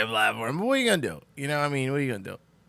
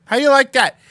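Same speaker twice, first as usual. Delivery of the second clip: gloating taunt; 12 semitones higher, 8 dB louder than usual. Real, not acted. Gloating about fooling the chat.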